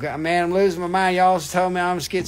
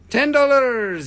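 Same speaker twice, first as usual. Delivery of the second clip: hammed-up seller voice; 7 semitones higher, 7 dB louder, slower than usual. Hamming up accented price call.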